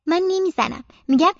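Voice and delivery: usual speaking voice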